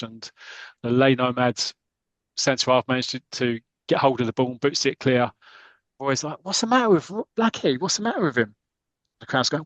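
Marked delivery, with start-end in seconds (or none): 6.4-8.5 high-pitched acted complaint; mock exasperation. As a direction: fret at a dithering teammate. Roy acted fuming at teammate.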